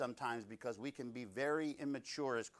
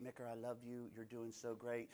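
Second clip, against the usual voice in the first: soft, tender reassurance, a little quieter; sweet and gentle; 9 dB quieter than usual. Acted. Preacher voicing a gentle God, soft and sweet. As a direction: coo it like a loving pat on the back. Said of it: "it's not this sweet".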